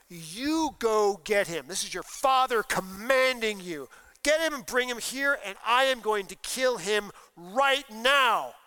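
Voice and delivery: the king demanding his son fetch David; furious command. Acted. Saul ordering Jonathan to bring David, furious and loud.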